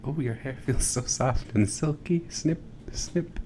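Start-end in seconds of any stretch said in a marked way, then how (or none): 2.3-3.4 whispered snip snip sounds; scissor snips. Pretend. ASMR haircut roleplay.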